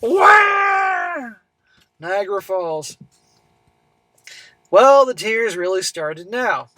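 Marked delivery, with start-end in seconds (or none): 0.0-1.3 a high, hammed-up crying wail, much higher; mock wail. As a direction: fake a baby's bawling cry to mock her tears. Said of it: "Let me guess".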